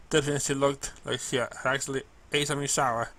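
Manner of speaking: ordinary delivery, nothing marked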